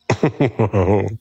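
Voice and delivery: rising taunting chuckle; a smug little laugh; mocking laugh. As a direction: laugh at how easy they are. Mocking the listener's anger.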